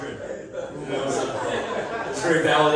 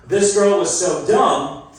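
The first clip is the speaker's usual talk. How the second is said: slow emphatic setup; 2 semitones higher, 5 dB louder, slower than usual. Shown as a demonstration. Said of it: "I need you guys to say".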